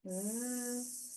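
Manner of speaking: soft, sustained zzz; steady buzz on one breath; buzzing bee. Shown as a demonstration. Teacher repeats bee breathing, soft buzz. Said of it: "humming again like a buzzing bee".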